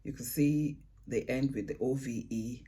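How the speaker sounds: ordinary talk, nothing marked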